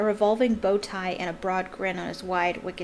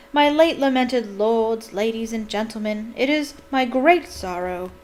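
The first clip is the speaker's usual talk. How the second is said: solemn speech; 2 semitones higher, 4 dB louder than usual. A narrator's reading. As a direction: open a mournful address with pomp. Opening a speech to crowd.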